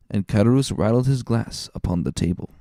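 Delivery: ordinary delivery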